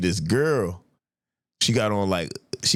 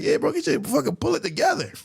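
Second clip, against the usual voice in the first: high-pitched mock scolding; retold scolding. Homie telling his high friend off, exasperated. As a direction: scold in a high, amused imitation.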